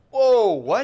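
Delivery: high, loud startled gasp; shocked. Retold or imitated. A listener reacts to grim news, high and startled. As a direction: jolt back in shock. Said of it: "You're like".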